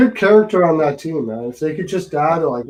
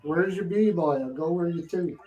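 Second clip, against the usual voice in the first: put-on Newfie accent; 3 semitones higher, 7 dB quieter than usual. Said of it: "can't understand a word they say".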